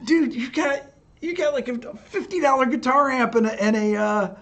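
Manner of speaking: high, fast, disbelieving friend voice; mock his buddy's outraged disbelief; a high, fast, half-laughing dude scolding; incredulous buddy. Jason scolding him again, retold.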